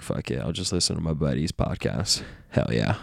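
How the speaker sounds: low, dropping surfer-dude voice; mock low dude impression; imitated buddy's voice. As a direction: imitate a laid-back buddy, pitch sinking low. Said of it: "That's how he talks too".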